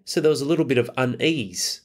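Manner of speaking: ordinary talk, nothing marked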